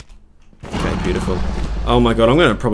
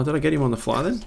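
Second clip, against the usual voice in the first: normal talking voice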